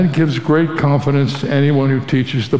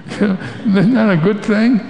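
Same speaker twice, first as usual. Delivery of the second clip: chuckling, higher-pitched aside; slow aside after a little laugh; amused chuckle; 7 semitones higher, slower than usual. Real, not acted. Light joke to the congregation.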